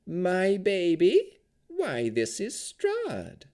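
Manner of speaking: high, slow doting crone voice; voice rising high. A narrator's reading. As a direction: coo it high like a doting hag. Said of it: "her voice rising an octave".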